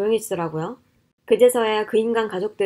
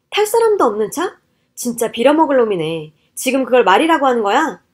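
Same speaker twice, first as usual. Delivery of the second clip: outraged disbelief; 7 dB louder than usual. Acted. Wife hurls husband's words back at him, outraged. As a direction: throw the words back in fury.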